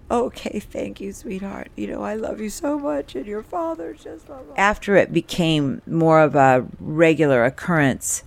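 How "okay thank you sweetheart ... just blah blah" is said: soft, hushed and much quieter, rising high at the end; mother's hush. Retold or imitated. Mother thanking her daughter at night, hushed and soft. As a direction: murmur it to the child like a fading goodnight. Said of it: "Mom, I will take your contacts out".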